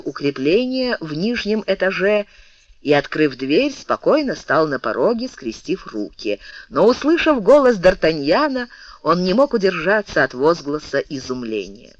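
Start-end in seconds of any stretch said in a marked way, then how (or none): none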